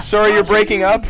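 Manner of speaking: high, loud bad-reception apology; loud phone voice. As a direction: shout over a breaking phone line. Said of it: "reception's terrible".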